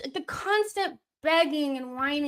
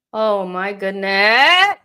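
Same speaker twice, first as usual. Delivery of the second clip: exasperated groan; 11 dB louder, slower than usual. Real, not acted. Frustrated at the match.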